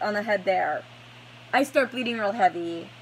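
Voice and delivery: usual speaking voice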